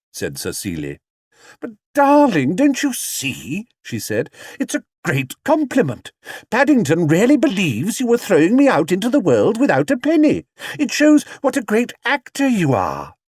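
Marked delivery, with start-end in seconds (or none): 1.6-13.1 very high, gushing lady voice; actress soothing. Acted. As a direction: coax the vain father. Sarah soothes her father.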